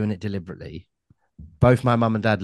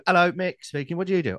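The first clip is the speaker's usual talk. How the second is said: higher-pitched phone-answering voice; phone greeting; 8 semitones higher than usual. Shown as a demonstration. Cohost showing how they answer the phone, phone greeting. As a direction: lift the pitch as if picking up a call. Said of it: "How do you answer the phone? I just go".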